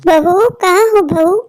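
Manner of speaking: put-on slow, strained call of an old mother. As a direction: call for her daughter-in-law, slowly. (बूढ़ी माँ की धीमी पुकार)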